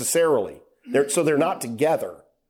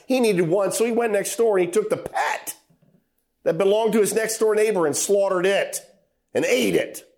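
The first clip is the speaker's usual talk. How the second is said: ordinary talk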